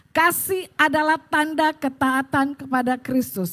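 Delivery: measured, emphatic Bible reading; scripture reading aloud. Real, not acted. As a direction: lead a congregation reading in unison. Leads congregation reading scripture together.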